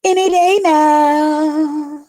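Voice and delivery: any day now, drawn out high and slow; excited sing-song. Shown as a demonstration. Repeating any day now, high and slow with longing. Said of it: "That's why I say any day now".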